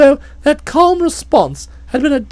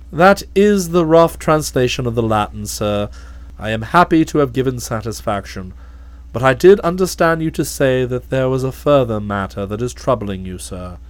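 low, calm, deferential butler voice; measured and unruffled, much lower; a low, imperturbable manservant; Jeeves the valet; 6 dB quieter than usual. Acted.

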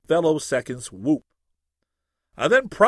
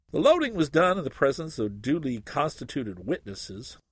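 ordinary delivery, nothing marked

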